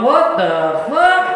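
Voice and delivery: loud, slow, stunned what the fuck; startled yell at what he found; shocked outburst. Retold or imitated. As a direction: drag it out in disbelief. Shocked at the ball gag.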